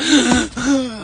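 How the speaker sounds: loud mock gasp; imitated shocked gasp. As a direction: suck in air, acting out her shock. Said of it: "She was like".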